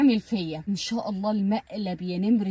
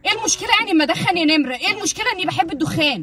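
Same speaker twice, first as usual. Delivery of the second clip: raised, fast, defiant retort; defiant outburst; 6 semitones higher, 4 dB louder, faster than usual. Acted. Wife faking defiance to husband in the smoking prank, loud and fast. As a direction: push back hard like you mean it.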